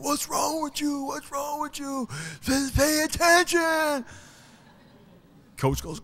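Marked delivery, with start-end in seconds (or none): 0.0-4.0 high-pitched imitation of yelling kids. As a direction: mimic indignant kids hollering, way up high. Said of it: "all the kids start yelling".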